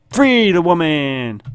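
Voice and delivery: high, slow drawn-out call; playful stretched-out declaration; mock proclamation. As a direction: announce it like a grand rescue. Heroically declares game choice.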